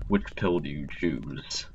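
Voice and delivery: slow, secretive whisper like Morpheus. Pretend. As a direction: whisper the offer like a mysterious mentor. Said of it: "I look like Morpheus".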